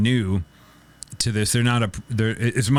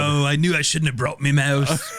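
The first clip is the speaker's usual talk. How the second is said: laughing mock Cockney lament; mock Cockney crook; 2 semitones higher than usual. Henchman regrets bringing his mouse, laughing mock Cockney. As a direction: whine about the mouse like a London crook.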